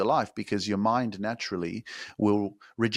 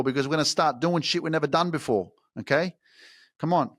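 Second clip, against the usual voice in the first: excited urging; 5 semitones higher than usual. Real, not acted. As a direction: fire them up to grab a pen. Urging viewers to write.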